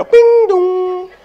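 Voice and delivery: high sung two-note ding-dong; doorbell chime. Acted. Doorbell rings with the check, sung chime imitation. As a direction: imitate a doorbell, long ringing notes. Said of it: "You hear the doorbell".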